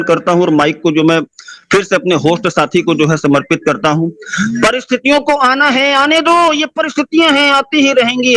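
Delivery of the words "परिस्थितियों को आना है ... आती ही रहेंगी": high, ringing rallying cry; pitched-up slogan for a closing speech; motivational oratory. Real, not acted. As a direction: declaim it high to rouse the crowd.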